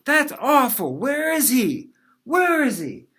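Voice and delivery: put-on high, loud indignant old-lady cry; hammed-up grandma outraged at her grandson. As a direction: demand where he is, scandalised.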